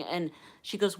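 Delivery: usual speaking voice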